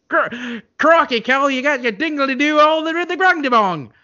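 high, loud mock Australian accent. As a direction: ham up a silly Australian accent. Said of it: "that thick Australian accent of hers".

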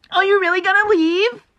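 very high, loud incredulous whine; mock upset partner. Mocking the upset girlfriend when he leaves, high incredulous whine. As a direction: squeal it in mock disbelief. Mocks partner's reaction to leaving.

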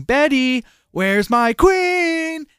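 high, slow wailing Ice King cry. Retold or imitated. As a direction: stretch it out high like a lost wizard calling his queen. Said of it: "Like, like Ice King".

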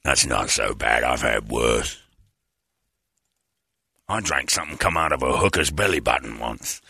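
low, slack aging rocker voice. Acted. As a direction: sink it deep and let the words slump.

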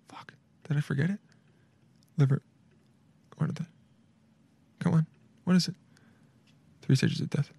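muttering to self. Real, not acted. Trying to recall forgotten term.

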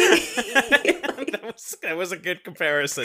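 breaking into high laughter mid-sentence; laughing hard. Real, not acted. Cohost laughing at his friend's comparison, high bursts of laughter. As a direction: laugh through the words, barely getting them out.

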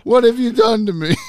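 high, loud cartoonish Biff squawk; Biff impression. Joking about a Saw-style trap, a hammed-up high Biff voice. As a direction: ham up a panicked Biff in a trap. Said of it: "I'm Biff from back to".

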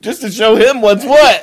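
big loud comic punchline; loud mock defiance. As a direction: belt it out as a joke. Joking punchline, shouted.

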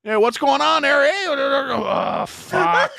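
high, loud shouting then laughter; mock rowdy yell. Imitating the angry hockey players, shouted high then laughing. Mocking hockey guys' reaction.

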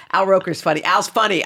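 loud, high, chattering fan; starstruck seatmate. Retold or imitated. Seatmate going on about Al Roker, loudly chatty. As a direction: babble on loudly like an eager fan.

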